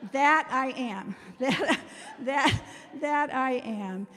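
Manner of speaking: "That I am" said slowly, loud and proud; proud emphasis. Real, not acted. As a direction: own it with a laugh, slow and loud. Proud joke about being organized.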